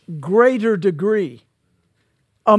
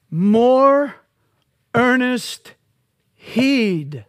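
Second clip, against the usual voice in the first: "More" hit loud and high, then "earnest heed" falling; stress on More; 4 semitones higher, 3 dB louder, much slower than usual. Shown as a demonstration. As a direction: lean hard on "More" and let "heed" drop. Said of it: "stressing the key phrase".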